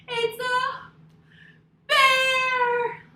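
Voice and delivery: high, loud mock-scared shout; startled shriek. Story teacher to kids, mock-scared shriek. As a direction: shriek at finding the bear.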